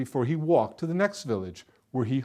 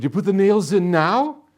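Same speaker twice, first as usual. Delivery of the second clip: louder, higher, rising sharply at the end; eager question; 5 semitones higher, 8 dB louder than usual. A narrator's reading. Villagers watching the bubbling pot, eagerly asking. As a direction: ask with impatient hope.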